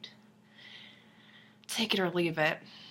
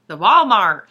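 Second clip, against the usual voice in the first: The Walmart! burst out much louder, falling; emphatic exclaim; 11 dB louder, slower than usual. Real, not acted. Repeating the Walmart to viewers, loud and incredulous. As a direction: shout The Walmart! in mock disbelief.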